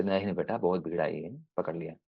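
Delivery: put-on a quiet, tender no to a small child; soft fatherly voice. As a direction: hush it like a caring dad calming his son. Father gently refuses his child.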